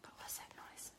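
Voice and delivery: whisper. Pretend. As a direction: pretend scared whisper. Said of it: "I'm just gonna be like".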